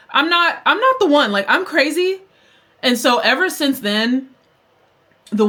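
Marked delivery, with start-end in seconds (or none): 0.1-2.2 high, emphatic declaration; hammed-up don't-mess-with-me; sassy boast. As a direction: insist with raised pitch and attitude. Acted boast after the warning.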